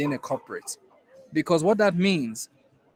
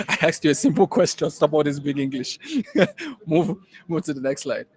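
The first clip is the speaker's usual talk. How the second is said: hammed-up mom voice with a scoffing laugh; 4 dB louder than usual. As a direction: brush off her son's big words, laughing. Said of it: "she goes".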